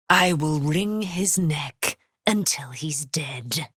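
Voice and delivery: slow, seething whisper; vengeful whisper. Acted. Aylin vowing revenge on the captor, slow whispered threat.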